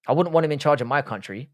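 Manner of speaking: ordinary talk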